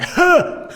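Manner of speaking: sharp high startled gasp. Retold or imitated. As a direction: jump at the knock on the glass. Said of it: "I turned around, I was like".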